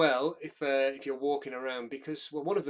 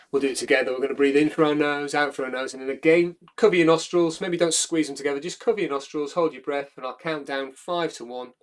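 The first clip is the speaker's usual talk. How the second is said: louder, measured breath-work instructions; guided breathing cue; 7 dB louder than usual. Acted. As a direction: lead the class through the drill. Leads recovery breath exercise.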